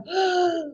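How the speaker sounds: sharp, high intake of breath; quick overwhelmed gasp, acted out; demonstrated gasp. Shown as a demonstration. Shows reaction to being overwhelmed.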